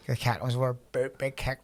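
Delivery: ordinary talk, nothing marked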